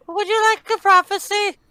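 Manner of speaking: very high squeaky granny voice; mock old lady voice.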